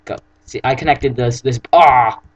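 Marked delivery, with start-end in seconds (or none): 1.4-2.2 sudden yelp mid-sentence; startled cry while falling. Real, not acted. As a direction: cry out as you drop. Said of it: "Ow, I fell".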